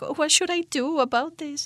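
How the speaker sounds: high-pitched fretting in mock self-doubt. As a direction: play a dithering heroine, pitched well up. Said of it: "doubting herself for being like".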